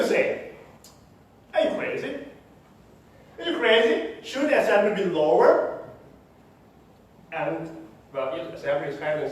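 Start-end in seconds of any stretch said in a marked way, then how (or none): 1.5-5.8 high-pitched disbelieving questions; incredulous objection. Retold or imitated. People challenge the speaker's claim about assembly, incredulous. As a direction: imitate a baffled sceptic, voice lifted high.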